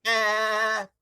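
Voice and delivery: bleating like a ram. Retold or imitated. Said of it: "Alec Baldwin's ram voice".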